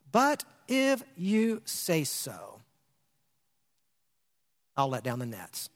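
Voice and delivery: slow, soft, giving in; drawn out, then dropping low and soft; reluctant surrender. Retold or imitated. Simon yielding to Jesus, slow and quiet. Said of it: "underline that statement".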